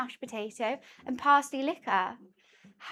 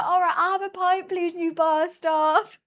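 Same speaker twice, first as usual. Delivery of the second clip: mock pub locals; 5 semitones higher, 5 dB louder than usual. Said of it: "locals were like".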